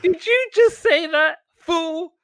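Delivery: very high, incredulous squawk; hammed-up crowd outrage; mock indignation. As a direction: challenge them in a high put-on voice. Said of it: "everyone turns around like".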